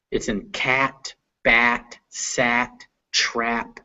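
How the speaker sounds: cat, bat, sat, trap said slowly and softly; model words. Shown as a demonstration. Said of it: "This is a very English sound".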